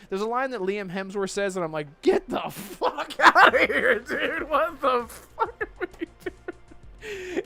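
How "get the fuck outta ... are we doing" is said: high, laughing, slowed-down disbelief; cracking up in disbelief at the trailer. Retold or imitated. Friend mocking the trailer to the stream, laughing.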